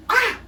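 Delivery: sudden high cry; high yelp. Retold or imitated. Pupil caught out, high yelp. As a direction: yelp in alarm. Kid caught by desk lid.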